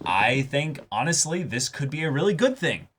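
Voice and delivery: ordinary delivery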